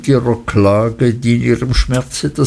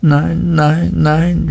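higher, mild cry of protest; understated narrated scream, pitched up; 6 semitones higher, slower than usual. A narrator's reading. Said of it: "schrie die kleine Emily".

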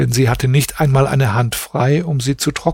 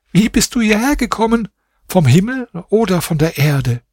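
higher, quick amazed question; astonished wife; 8 semitones higher than usual. A narrator's reading. Wife sees her husband suddenly appear, higher amazed voice. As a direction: ask in amazed disbelief. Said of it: "Dann sagte sie".

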